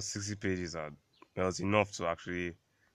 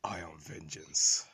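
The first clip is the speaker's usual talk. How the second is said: a slow, whispered, lightly done Batman line; Batman voice attempt; 3 semitones lower, much slower than usual. Co-host attempting Batman, slow half-hearted whisper. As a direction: try a Batman growl but it comes out whispered. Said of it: "let me do this here".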